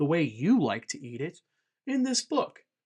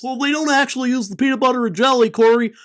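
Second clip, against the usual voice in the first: higher, quick pedantic correction; mock nitpicky commenter; 8 semitones higher, 6 dB louder, faster than usual. Imitating a viewer correcting the creator in the comments, nasal-free mock pedantry. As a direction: needle him like a know-it-all commenter. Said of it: "You don't need to write in the comments".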